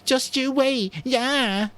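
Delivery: high, loud mocking whine; mimicked whine. A narrator's reading. Jack mocking Piggy's whine for the hunters. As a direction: parody Piggy's threat. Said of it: "Jack mimicked the whine".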